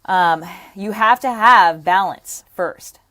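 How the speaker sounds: slow emphatic teacher stress on have. Real, not acted. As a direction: lean hard on have, spell out the rule. Stressing the key rule.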